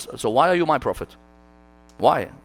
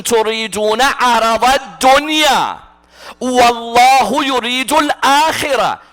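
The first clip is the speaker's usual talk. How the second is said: Quranic recitation; 7 semitones higher, 5 dB louder than usual. Acted. Preacher intoning the verse to the congregation, recited. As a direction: recite it like scripture.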